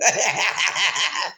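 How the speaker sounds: real outburst of laughing; a big loud laugh breaking out; loud burst of laughter. Real, not acted. Picturing blind one telling blind two to turn left, loud laughter. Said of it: "I just have to laugh".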